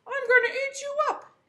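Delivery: high, pushed troll threat; menacing troll. Acted. Troll threatening to eat the goat, high. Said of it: "the troll says".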